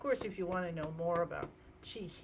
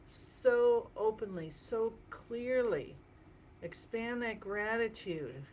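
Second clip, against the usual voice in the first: slow, louder, uplifted guidance; serene tai chi cues; 3 semitones higher, 4 dB louder, slower than usual. Acted. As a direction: draw out each word, rising. Guided healing exercise.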